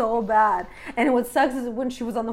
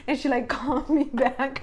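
ordinary talk

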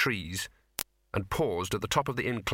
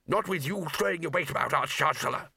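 higher, put-on bickering voice; exasperated retort; 5 semitones higher than usual. A narrator's reading. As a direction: snap back at a meddling passenger. Flyer retorts on broomstick.

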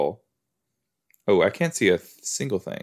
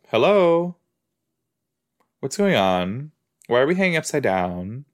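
ordinary talk

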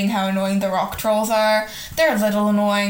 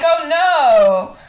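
mock panic; 6 semitones higher, 6 dB louder than usual. Mocking the trolls.

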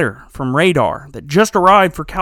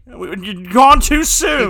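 slow, higher, hammed-up lament; mock mourning; 6 semitones higher, 3 dB louder, much slower than usual. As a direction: sigh it out like a fake eulogy.

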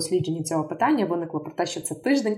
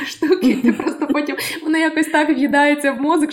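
laughing; 8 semitones higher, 6 dB louder than usual. Real, not acted. Laughing after the British imitation.

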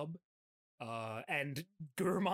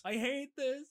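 high, mock-distressed wail; put-on high voice whining from the background; dissonant background complaint; 9 semitones higher than usual. Mimicking the dub's background line, high mock complaint. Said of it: "very dissonant in the background, you can just hear him".

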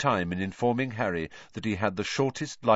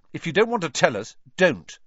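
fast, higher, louder retort; snapping; 9 semitones higher, 6 dB louder than usual. A narrator's reading. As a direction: cut her off sharply. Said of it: "snapped Ron".

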